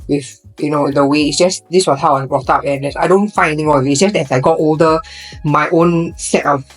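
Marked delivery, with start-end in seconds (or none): none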